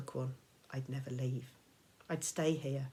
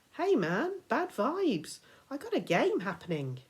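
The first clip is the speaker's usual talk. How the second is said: put-on higher, laid-back stoner kid voice; dismissive gamer kid; 8 semitones higher, 4 dB louder than usual. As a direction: brush him off like a bored teen. Gamer kid brushes Percy off.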